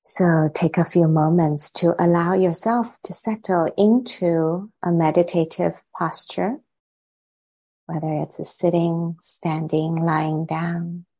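unhurried meditation guidance, long silences between phrases; guided meditation. Acted. Teacher settling the group into meditation, calm and spacious. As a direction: guide them calmly, softening as phrases settle.

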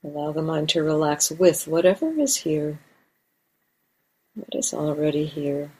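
calm, unhurried meditation guidance with long pauses; guided meditation. Real, not acted. Teacher guiding meditators to arrive in the body, guided meditation. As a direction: settle listeners gently, leaving space between phrases.

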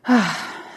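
audible exhale to settle; deep breath sigh. Shown as a demonstration. Meditation teacher invites listeners to breathe along, settling sigh. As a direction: let a breath go in a relaxed sigh. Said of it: "I'm gonna take a breath then".

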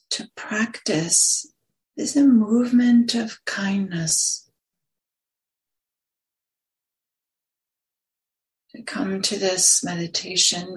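unhurried meditation guidance with long pauses; lingering on words, leaving long silences between phrases; guided meditation. Acted. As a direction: guide listeners gently into stillness, phrase by phrase.

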